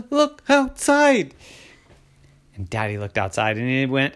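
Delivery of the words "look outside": high, loud, drawn-out alarm; frightened urging. Acted. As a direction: gasp it out slowly in fright. Terrified kids point out snakes.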